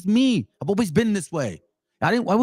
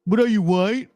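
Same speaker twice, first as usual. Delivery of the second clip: higher, mock-incredulous question; mocking quote; 4 semitones higher than usual. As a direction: imitate a scoffing challenge. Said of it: "even our gente tells me".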